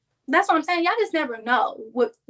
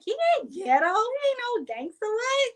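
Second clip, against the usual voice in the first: defensive friend; 12 semitones higher than usual. Retold or imitated. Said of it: "She's like".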